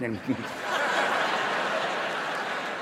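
soft breathless giggling; mock giggle. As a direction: imitate girls tittering. Girls giggling over phones.

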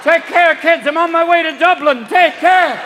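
high, loud mock farewell; calling out farewell. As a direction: call back to the kids as you dance away. Grandma dancing off to Dublin.

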